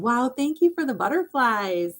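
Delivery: cheery high aside to a viewer; bright thanks. Real, not acted. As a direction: beam at the gift. Livestream viewer sent butterflies.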